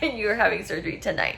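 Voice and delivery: laughing through the words, quick; can't stop laughing while saying it; giggling, a bit fast; laughing disbelief. Real, not acted. Laughs quoting the doctor.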